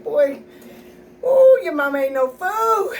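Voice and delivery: a playful I-told-you-so to the son; knowing mom sass. Retold or imitated. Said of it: "It's like".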